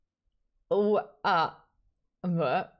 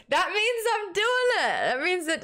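thrilled squeak; 12 semitones higher than usual. Real, not acted. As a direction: squeal it with glee. Proud the voice is right.

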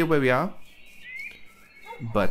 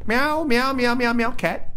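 high-pitched mock meows; playful cat meowing; 13 semitones higher, 4 dB louder, slower than usual. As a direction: meow back at the cat in a high voice.

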